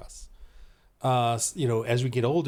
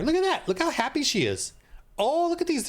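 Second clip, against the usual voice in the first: mock delight; 11 semitones higher than usual. Real, not acted. Mocking happy faces on screen.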